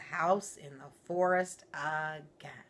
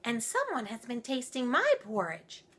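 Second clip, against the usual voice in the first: higher fussy mother bear; mama bear voice; 7 semitones higher than usual. Acted. Mama Bear complaining about her porridge, higher. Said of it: "said the Mama Bear".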